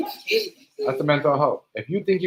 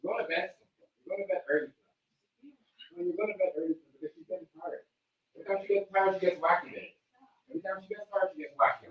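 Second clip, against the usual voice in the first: much softer, hushed telling-off; 6 semitones higher, 13 dB quieter, faster than usual. Real, not acted. Telling the tired child to go to bed, hushed. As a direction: keep it down, she's sleepy.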